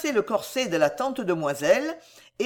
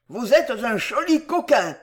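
put-on angry old man's outburst, higher pitched; acted fury, pitched up; panting with anger; 4 semitones higher, 4 dB louder than usual. A narrator's reading. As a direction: cry out in breathless indignation. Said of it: "s'écria le vieux Wardle, haletant de colère".

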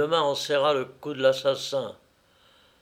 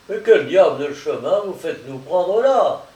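loud, startled protest; indignant exclamation; 3 semitones higher, 6 dB louder than usual. Acted. Strong to his cousin about the odd route, exclaiming. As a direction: cry out in puzzled objection. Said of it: "s'écria Strong".

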